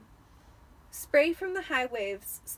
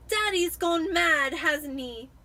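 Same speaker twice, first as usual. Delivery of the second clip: put-on high, louder, slow sulky boy; dull Dudley; 6 semitones higher, 8 dB louder, slower than usual. A narrator's reading. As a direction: drag it out like a bored, spoiled kid.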